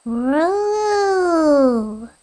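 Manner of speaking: long drawn-out call into the woods; calling out for a lost dog; long holler. Acted. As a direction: holler his name across the distance. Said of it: "He aired a long holler into the woods".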